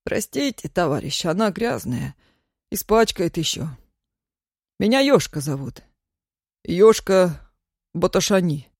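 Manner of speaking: meek, apologetic man's voice; a hint of a fawning petitioner.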